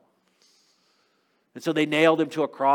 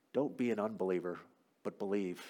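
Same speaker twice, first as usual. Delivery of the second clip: low, hushed, tender urging; quiet, lowered voice of reassurance; gentle appeal; 6 semitones lower, 12 dB quieter than usual. Acted. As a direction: speak softly and low, as if to one doubter. Jesus gently addresses Thomas.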